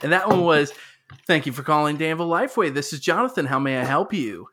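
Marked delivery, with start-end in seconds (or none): 1.3-4.5 phone greeting voice. Retold or imitated. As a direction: rattle off the store phone greeting. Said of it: "two different voices".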